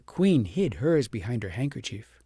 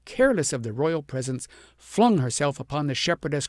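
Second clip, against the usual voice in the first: usual speaking voice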